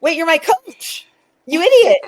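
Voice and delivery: high, loud, slow indignant outcry; mock outraged retort; exasperated disbelief. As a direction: throw it back at him in disbelief. Exasperated reply to her coach.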